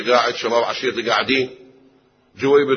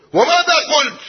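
put-on "وماذا قلت؟" much higher and louder in a young girl's voice; insistent high repeat; 6 dB louder than usual. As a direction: press her father for the answer, voice rising. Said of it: "عفية بنية، عفية تربية".

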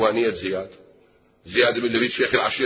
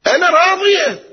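much higher and louder, bursting with joy; eager consent; 8 dB louder than usual. Acted. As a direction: blurt out your yes to the marriage. Daughter eagerly accepts the match.

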